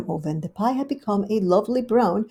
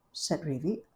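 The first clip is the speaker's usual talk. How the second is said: ordinary delivery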